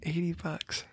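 hushed shocked whisper. Real, not acted. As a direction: whisper it in disbelief.

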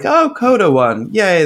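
high-pitched little retold cheer; happy cheer. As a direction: pitch it up high, recalling being pleased. Reenacting reaction after award show.

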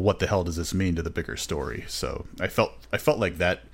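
normal talking voice

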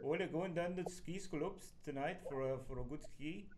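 playful mock Austrian accent. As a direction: put on an Austrian accent for fun. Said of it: "my version of the Austrian accent".

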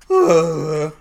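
loud cartoon bear groan; sudden heavy grunt. Sleeping bear beside Donald, loud mock grunt.

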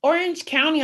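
high, put-on claimer voice; mocking imitation. Imitating Orange County people claiming LA, mocking.

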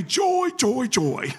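high, loud chant of joy joy joy; imitating the old saint's high loud "Joy, joy, joy"; old saint imitated. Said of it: "That's how he said it".

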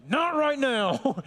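exasperated cry at well-meant comfort; frustrated retort. Acted. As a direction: push back in frustration, drawing it out. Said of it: "And it's frustrating".

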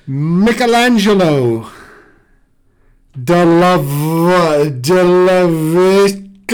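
slow, hammed-up Italian accent. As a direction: roll the names like an Italian. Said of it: "a certain flow to your Italian accent".

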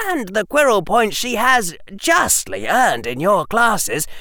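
high, emphatic schoolboy speech; diplomatic young Harry. Acted. Harry arguing against Granger's command, diplomatic. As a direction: make a careful formal case, pitched high.

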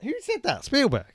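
high, loud indignant questions; high-pitched mock fury; indignant demand. Real, not acted. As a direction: demand answers, rising high. Indignant demand about Spielberg.

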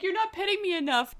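high whiny pet voice. Acted. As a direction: complain like a needy cat. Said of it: "He's like".

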